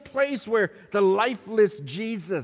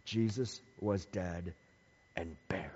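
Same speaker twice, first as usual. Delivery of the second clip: hushed; 11 semitones lower, 8 dB quieter than usual. Acted. Solemn close on the burial.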